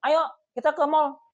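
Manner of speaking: loud, high, bossy invitation; mock demanding girlfriend calling out; pushy shout. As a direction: order him to the mall, high and loud. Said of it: "Mana yang lebih enak".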